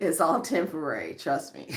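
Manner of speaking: a chuckling aside; laughing. Real, not acted.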